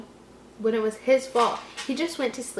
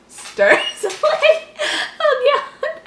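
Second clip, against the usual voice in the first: laughing; 6 dB louder, much slower than usual. Real, not acted. Laughing at odd phrasing.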